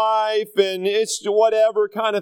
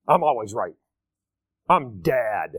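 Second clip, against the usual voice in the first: know-it-all dad; 10 semitones lower than usual. Acted. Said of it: "the know-it-all attitude".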